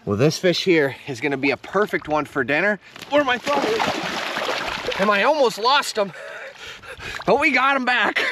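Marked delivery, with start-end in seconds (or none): 3.1-8.2 excited. Real, not acted. Excited catch while landing fish.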